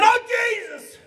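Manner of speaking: high, loud startled shriek; a man jumps at a stranger in the dark and screams; terrified scream. Retold or imitated. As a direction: shriek it out in sudden fright. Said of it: "He screamed".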